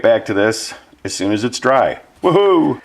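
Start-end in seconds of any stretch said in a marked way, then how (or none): 2.2-2.8 excited. Real, not acted. Cheering finished paint job.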